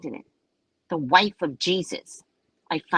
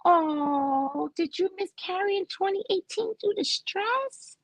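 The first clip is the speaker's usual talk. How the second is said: much higher, whiny gushing; mock sympathy; 5 dB quieter, slower than usual. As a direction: overdo the concerned friend.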